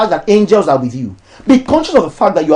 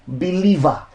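ordinary talk

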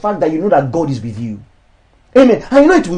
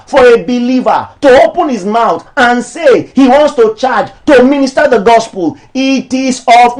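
raised, declamatory sermon voice; preaching; 4 semitones higher, 4 dB louder than usual. Acted. Pastor preaching against charging for ministry, declamatory. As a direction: preach it to the church, each line landing.

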